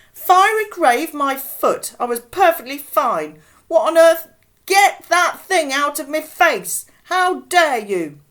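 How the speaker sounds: shrill, very loud outrage; furious. A narrator's reading. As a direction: scream it indignantly. Old woman outraged at fireman.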